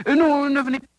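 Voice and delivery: high, flustered refusal; alarmed backtracking; panicked. Acted. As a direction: blurt it out high to stop someone coming in. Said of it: "pris de panique".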